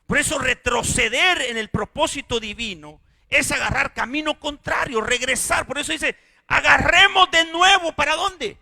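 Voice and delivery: loud, high, impassioned preaching; loud pulpit cry over the congregation; full-force preaching. Acted. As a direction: preach it with rising fire. Preaching build.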